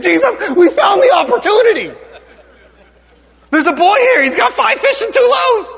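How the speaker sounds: high, hammed-up eager announcement; excited disciples. Disciples reporting the boy's lunch to Jesus, excited high voice. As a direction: blurt the find out in a high voice.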